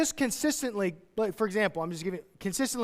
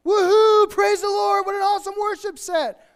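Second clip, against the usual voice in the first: high, loud hammed-up cheering; mock worship hype; 12 semitones higher, 9 dB louder than usual. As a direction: parody a churchgoer whooping on Sunday. Mocking Sunday worship hype.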